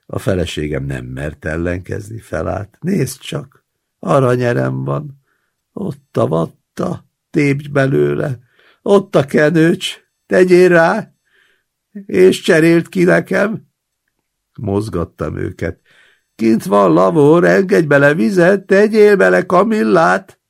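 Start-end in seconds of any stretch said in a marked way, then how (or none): 2.8-13.7 put-on high, loud pleading old patient; a demanding invalid whining orders at his grandson; whiny old man. Old patient whines orders at his grandson, high and loud. 16.3-20.4 put-on high, loud pleading old patient; a demanding invalid whining orders at his grandson; whiny old man. Old patient whines orders at his grandson, high and loud.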